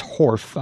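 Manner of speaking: horf, final F for S; accented horf. Shown as a demonstration. Host decoding the accent for Rob: horf. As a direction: say horf with an F ending. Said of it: "when he says".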